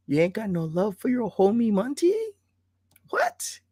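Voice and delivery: laid-back rapper impression. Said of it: "I'm doing the whole [name] thing".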